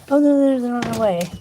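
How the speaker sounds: high and loud, mocking his excuse; mocking imitation of him.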